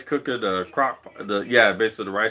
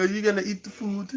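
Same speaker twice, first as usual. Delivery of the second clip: high, softer mock Jamaican parent; Jamaican accent; 7 semitones higher, 7 dB quieter, faster than usual. Strict parent telling a boy to eat, in a Jamaican accent. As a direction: imitate a Jamaican elder, pitched up and quieter. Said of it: "Jamaicans. They used to always be like".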